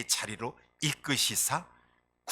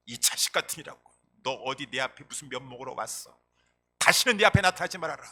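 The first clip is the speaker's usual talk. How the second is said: mock angry father; 8 dB louder than usual. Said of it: "탕자 아버지가".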